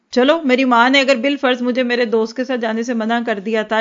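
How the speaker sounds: normal talking voice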